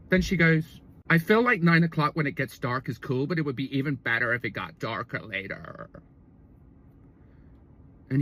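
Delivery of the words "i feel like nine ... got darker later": put-on croaky Californian girlfriend. Girlfriend telling him about yoga, trailing croaky voice. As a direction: start normally, then fade into creak. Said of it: "run on and speak like this".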